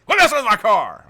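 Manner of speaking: high, loud outraged yell; shouted, high-pitched indignation; furious dad. Retold or imitated. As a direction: imitate an angry father bellowing at his kid. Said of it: "He's fucking furious".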